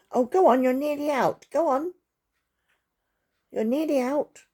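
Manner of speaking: high, loud pet-talk; coaxing. Real, not acted. Coaxing an animal outside.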